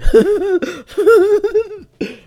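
loud high burst of laughter; real burst of laughter. Real, not acted. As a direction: crack up at your own joke. Laughs at own joke.

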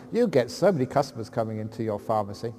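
usual speaking voice

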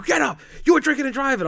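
loud, high-pitched accusation; retold angry father. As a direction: imitate a dad yelling him awake. Father rousing hungover son, retold.

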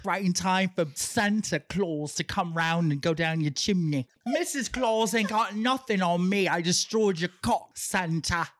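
put-on cheeky British accent, flirting; playful put-on British come-on. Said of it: "stop doing the accent".

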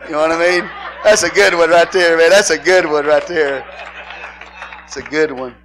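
laughing loudly through the words; cracking up at his own joke; words spilling out between real laughs. Real, not acted.

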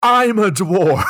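slow, high, loud mock yell; mock shout. Friend quoting a movie line, loud mock shout. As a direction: belt it out like a furious actor. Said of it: "It's Matthew McConaughey saying".

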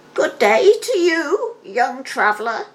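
put-on high, crackly old crone greeting; an old hag calling to a passer-by; high witchy beggar voice; old beggar woman. A narrator's reading. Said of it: "said she".

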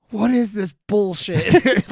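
loud, high, drawn-out disbelief; confused outrage. Retold or imitated. Recalling commercials at a non-Alamo, confused mock outrage. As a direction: stretch it out in appalled bafflement. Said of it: "I was, like, confused".

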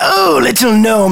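high, loud, drawn-out lordly voice; patronizing grand noble; condescending lord. Acted. Lord looks down on the gnome, condescending.

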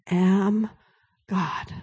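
slow, near-whispered; hushed voice of God. Retold or imitated. Preacher voicing God's gentle word, slow and hushed. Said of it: "he says it again".

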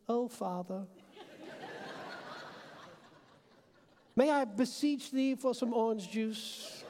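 slow, ornate mock-pious plea; a kid asking for juice in churchy old English; mock formal child. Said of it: "I gotta put on my formal voice".

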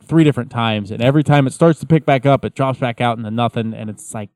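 usual speaking voice

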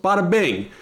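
quick Brooklyn bada bing, falling; punchy wiseguy catchphrase; brooklyn accent. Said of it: "talk in a Brooklyn accent".

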